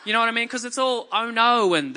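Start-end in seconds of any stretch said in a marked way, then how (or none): 1.1-1.7 loud, exaggerated Australian accent; mock an over-the-top Aussie film accent; hammed-up Australian movie voice. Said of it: "an Australian accent on a movie".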